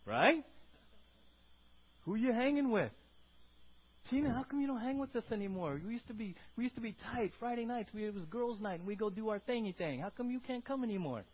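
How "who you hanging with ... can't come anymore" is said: lightly voiced, higher-pitched puzzled friend; a hint of a pleading girlfriend; complaining friend. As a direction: play a left-out pal asking why, pitched up. Voices friends questioning a changed churchgoer.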